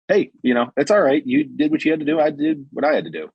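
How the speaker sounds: smooth it over like it's no big deal; imitating a breezy, forgiving tone; mock reassuring. Cop reassures arrested man.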